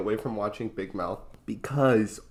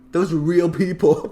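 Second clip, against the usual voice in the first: louder, higher indignant protest; mock outrage; 6 semitones higher, 7 dB louder than usual. Brother objecting to laughing at cartoon, mock-indignant cry.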